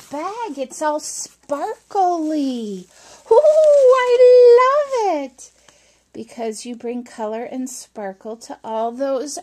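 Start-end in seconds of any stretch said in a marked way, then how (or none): 3.3-5.5 excited squeal. Real, not acted. Unwrapping a sparkly bag.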